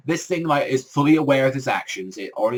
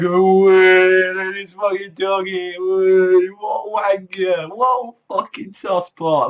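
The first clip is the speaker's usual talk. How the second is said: high, drawn-out heckling call; mock jeering; 6 semitones higher, 6 dB louder, much slower than usual. Passers-by on the town mocking whoever is up a tree, jeering. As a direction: holler it like a rowdy heckler.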